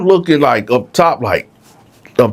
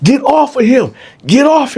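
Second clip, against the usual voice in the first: commanding shout; 14 semitones higher than usual. Retold or imitated. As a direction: bark the order again. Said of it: "I can just hear what he said".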